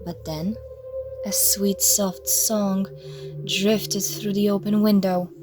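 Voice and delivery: usual speaking voice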